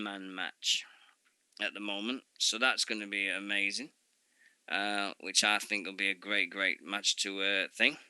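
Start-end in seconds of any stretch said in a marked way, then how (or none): none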